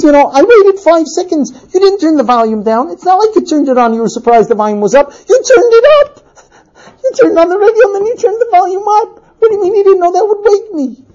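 argument climbing to high, fast rant; mounting exasperation. Acted. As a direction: build from reasoning to squealed outrage. Husband's rant building in quarrel.

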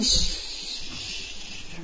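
long drawn-out shush; hushing. Retold or imitated. Wife settling her woken husband, soothing shush. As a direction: hush him back to sleep.

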